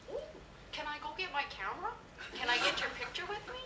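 high, eager imitation of the neighbour; Newfoundlander neighbour. Neighbour asking the famous writer for a picture, high excited mimicry. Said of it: "the neighbor says".

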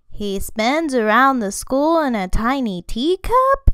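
loud, incredulous question rising at the end; shocked kitty boy; exclaimed in shock. A narrator's reading. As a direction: ask it in amazed disbelief. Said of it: "exclaimed the kitty boy in shock".

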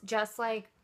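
speaking while sniffing jar; muffled behind held jar; jar held to mouth. Real, not acted. Seen 0: Jar pressed under nose over mouth 1.1–2.6s, then lowered.

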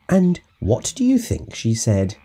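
ordinary delivery

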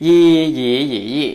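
ยี หยี หยี ยี่ loud through all four tones; four-tone drill. Shown as a demonstration. Teacher demonstrating tones to learners, four-tone drill. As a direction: recite ยี level, rising, dipping, then falling.

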